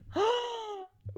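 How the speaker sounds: sharp high gasp; surprised gasp. Real, not acted. Gasp at her entrance.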